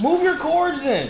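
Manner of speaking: high, slow, whiny comeback; irritated retort. Real, not acted. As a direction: snap back in a petty squabble. Squabble over stepping on cords.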